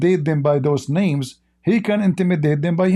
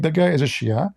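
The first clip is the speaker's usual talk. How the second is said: ordinary delivery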